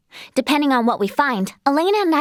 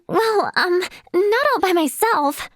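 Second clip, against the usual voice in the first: usual speaking voice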